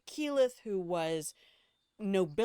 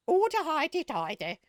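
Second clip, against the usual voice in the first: loud, high mock leprechaun; Lucky Charms leprechaun; 10 semitones higher, 7 dB louder than usual. Mocking actors' Irish accents, hammed-up leprechaun voice. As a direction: send up a bad Irish accent, pitched way up. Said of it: "everybody sounds like the fucking Lucky Charms leprechaun".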